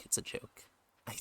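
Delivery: whispered aside; breathy, voiceless whisper. Real, not acted. Repeats disclaimer whispered.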